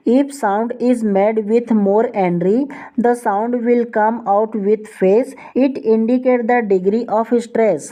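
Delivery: each English word read out very slowly for students; slow, deliberate classroom reading of a definition; slow dictation reading. Shown as a demonstration. Teacher reads definition aloud.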